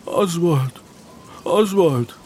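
high, tearful name; sobbed. A narrator's reading. Boy answers the roll call, sobbing. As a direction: say your own name through sobs. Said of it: "er schluchzte".

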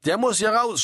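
narrator's restrained yell; mild shout. A narrator's reading. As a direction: call out an order in an emergency. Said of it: "schrie er".

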